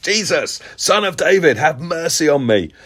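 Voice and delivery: high cry at ordinary volume; a restrained imitation of a beggar's shout; mock shout. Preacher re-enacting the beggar getting God's attention, half shouting. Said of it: "he shouts to get God's attention".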